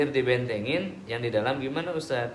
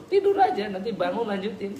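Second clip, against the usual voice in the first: laughing; 7 semitones higher, 4 dB louder than usual. Acted. Ustaz mocking tempter's advice.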